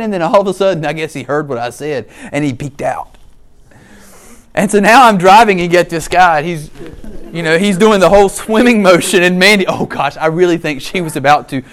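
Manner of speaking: ordinary talk, nothing marked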